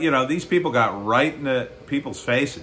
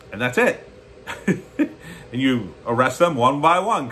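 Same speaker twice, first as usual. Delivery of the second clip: normal talking voice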